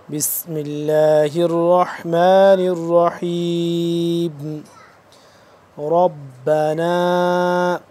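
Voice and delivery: slow, drawn-out melodic tajweed recitation; Quran recitation. Acted. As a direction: recite it slowly with full tajweed. (قرآن کی تلاوت، آہستہ اور سریلی)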